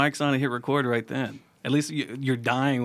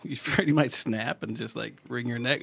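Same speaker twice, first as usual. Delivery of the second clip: normal talking voice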